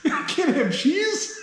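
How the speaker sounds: high giggling mock horror; laughing disbelief. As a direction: retell the shock while cracking up. Mock-horrified about giving up cheese.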